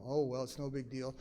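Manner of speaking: low, soft and unconcerned; unbothered shrug. Retold or imitated. Some sleepers just shrug off being woken, nonchalant. Voices the less dynamic sleepers.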